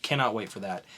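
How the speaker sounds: ordinary delivery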